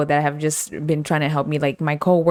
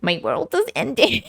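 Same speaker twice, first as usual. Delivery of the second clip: melodramatic world-ending whine; mock despair; 4 semitones higher than usual. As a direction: ham up the despair. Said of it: "I'm literally just like".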